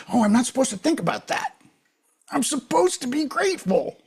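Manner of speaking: high-pitched fretful inner voice; mock self-scolding. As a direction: imitate someone anxiously policing their own thoughts. Mimicking anxious self-scolding thought.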